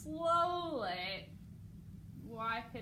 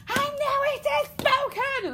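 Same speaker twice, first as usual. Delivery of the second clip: temper tantrum; 9 dB louder than usual. Shown as a demonstration. King erupting at the defiant girl, tantrum shout. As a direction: throw a tantrum on every word. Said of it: "temper tantrum".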